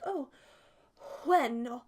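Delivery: lightly voiced posh lady, slow; drawn-out hint of a posh client; mild posh lady impression. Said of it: "she's a very posh lady".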